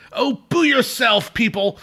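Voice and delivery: high, slow, haughty villain voice; a stuffy snob sneering back at the crowd, pitched high and slow; stuffy Captain Hook. Retold or imitated. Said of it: "high stuffy kind of guy".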